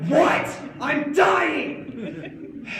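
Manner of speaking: very high, loud disbelieving yell; exasperated scream. Acted. Mauled student answers teacher's quiz question, exasperated scream.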